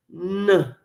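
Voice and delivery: ordinary talk, nothing marked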